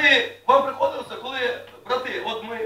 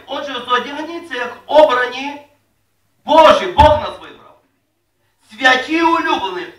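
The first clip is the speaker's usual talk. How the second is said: scripture reading; 10 dB louder than usual. Acted. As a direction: proclaim each word to the church. Reads scripture aloud.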